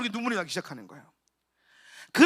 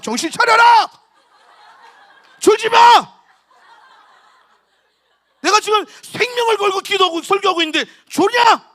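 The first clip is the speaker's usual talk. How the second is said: full-force scolding yell, high and very loud; furious preacher shouting; 7 semitones higher, 11 dB louder than usual. Retold or imitated. Visiting pastor yelling at dozing children as he preaches, furious shout. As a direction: roar at a dozing congregation. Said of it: "막 소리를 지르는 거야".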